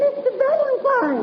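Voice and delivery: high, urgent call across the garden. Acted. Miss Dowdy calling after Mister Valentine, high and urgent. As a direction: call his name to stop him.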